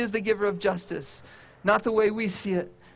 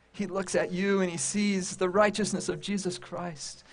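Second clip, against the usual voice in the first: ordinary talk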